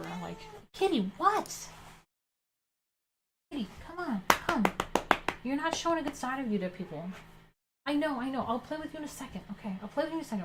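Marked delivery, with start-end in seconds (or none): 0.7-7.1 much higher pet-scolding voice; high-pitched cat talk. Real, not acted. Talking to her cat mid-story. 7.9-10.4 high voice soothing an impatient cat; placating pet talk. Real, not acted.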